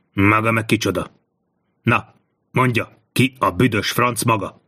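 understated hostile hiss. A narrator's reading. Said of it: "sziszegte a pilóta felé". (visszafogottan sziszegve)

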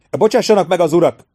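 loud, high call over the shoulder; shouted back. A narrator's reading. Aruta calling back to the stunned nobles, loud shout. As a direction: shout it back while riding off. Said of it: "kiáltott vissza Aruta".

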